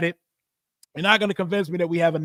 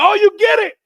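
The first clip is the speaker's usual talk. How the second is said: much higher and louder, thrilled; excited to son; 13 semitones higher, 10 dB louder, faster than usual. Retold or imitated. Father telling his son he gets the joke, thrilled. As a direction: burst out proud and delighted. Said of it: "I was like".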